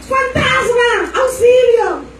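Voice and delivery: high, drawn-out mock scream of panic; panicked yelling. Disciples in the storm crying out at the ghost, high mock panic. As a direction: cry out like terrified fishermen seeing a ghost. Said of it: "Todo el mundo gritando".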